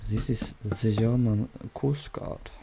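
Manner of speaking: put-on German accent. Coast guard answering the distress call, thick German accent. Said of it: "I should have put on a German accent".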